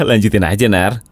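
normal talking voice

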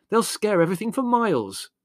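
high, loud, exasperated outburst; worked-up protest; heated. A narrator's reading. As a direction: complain heatedly, pitch leaping up. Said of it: "he inquired heatedly".

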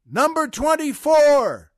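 high, loud drawn-out holler; shouting out. Acted. Prisoner calling out a joke number to the cell block, shouting. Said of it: "he shouts out".

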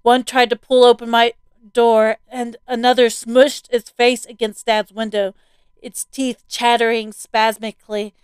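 ordinary delivery, nothing marked

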